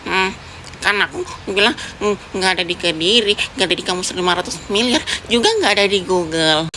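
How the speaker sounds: a dim classmate, drawled low and doubtful; low-voiced puzzled boy. Diko again, the low-voiced classmate. (suara anak laki-laki berat dan bingung)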